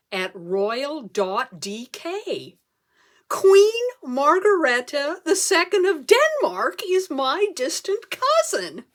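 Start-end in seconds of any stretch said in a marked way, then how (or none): 3.3-8.9 excited announcement. Acted. Reading out the royal email.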